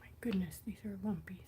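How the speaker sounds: hushed whisper. Real, not acted. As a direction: breathe it out under your breath. Whispered aside.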